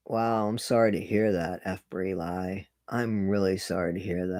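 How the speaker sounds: soft, low and sincere condolence; gently sorry, voice dropped quiet; sincere sympathy. Real, not acted. Consoling a viewer who was sick.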